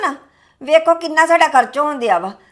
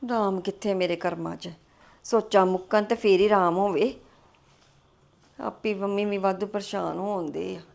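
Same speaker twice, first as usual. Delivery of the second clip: much softer, low, weary lament; despairing sigh; 6 semitones lower, 11 dB quieter than usual. Acted. Wife alone lamenting her worries, sad and hushed. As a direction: sink into hopelessness, talking to yourself.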